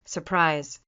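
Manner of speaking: ordinary delivery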